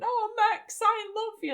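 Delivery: high, hammed-up weepy declaration; mock tearful gush. Jimena tearfully telling Max she loves him, mock weepy. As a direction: gush it out like a melting heart. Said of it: "and she's crying".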